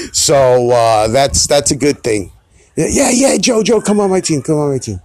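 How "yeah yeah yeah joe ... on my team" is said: higher, excited imitation of pleading friends; eager friends. Friends at Pictionary begging Joe to join, higher excited imitation. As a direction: ham up the teammates begging for him.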